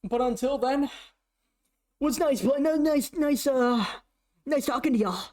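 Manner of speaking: high hammed-up repeated nice; impression of The Lash. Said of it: "who I'm stealing that impression from".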